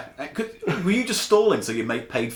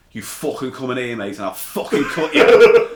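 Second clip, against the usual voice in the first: retold threat in put-on Scouse, louder towards the end; Scouse accent; 7 dB louder than usual. Retelling his threat to a burglar, mock Scouse accent. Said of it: "in my best Scouse accent".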